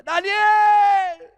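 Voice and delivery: loud, high anguished calling out of a name; desperate king calling into the pit; anguished shout. Acted. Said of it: "gritó con angustia".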